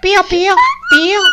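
high chirpy chick peep. Pretend. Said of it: "Ahora con voz de pollito".

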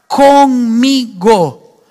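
"conmigo" drawn out very slowly, loud; emphatic stress. Real, not acted. Preacher urging work on oneself, "conmigo" said slowly and firmly. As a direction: stretch "conmigo" syllable by syllable for emphasis.

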